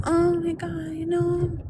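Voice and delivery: high, soft, slow mock whimper of a useless apology; mocking a meek apology. Mocking the silent streamer's meek excuse, high soft whimper. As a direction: ham up the limp, weepy excuse to sneer at it. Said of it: "But you going".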